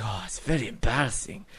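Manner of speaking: soft put-on accented henchman voice; hushed, accented mock embarrassment; Kaufman. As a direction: imitate a sheepish film henchman, quietly. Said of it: "just Kaufman's like".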